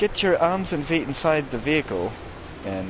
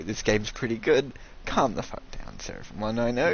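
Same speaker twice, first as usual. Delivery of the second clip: usual speaking voice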